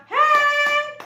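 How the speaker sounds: sharp loud high hey; shout. Shown as a demonstration. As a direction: snap the room to attention. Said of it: "Atau bisa juga". (teriakan keras "hey")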